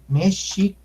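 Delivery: México as "mesh-shee, co", doubled sh; lengthened pronunciation. Shown as a demonstration. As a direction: stretch the sh in "México" and break before the last syllable. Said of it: "o sea, alargándole".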